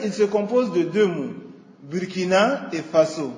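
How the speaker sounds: ordinary talk, nothing marked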